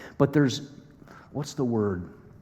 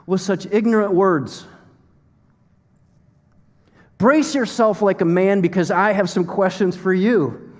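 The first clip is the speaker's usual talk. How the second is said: raised, commanding scripture voice; God challenging Job; 5 semitones higher than usual. Acted. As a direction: open high, then lay down the challenge. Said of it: "God is looking at a human being and he says".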